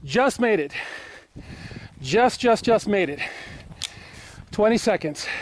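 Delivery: panting out of breath after the drill. Real, not acted. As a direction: talk while catching your breath. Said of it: "let me catch my breath".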